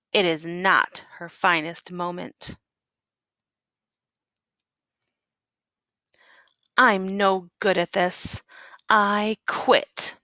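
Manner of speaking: story narration. Acted. Teacher reads picture book aloud.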